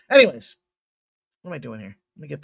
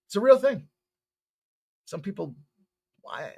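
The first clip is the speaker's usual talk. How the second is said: usual speaking voice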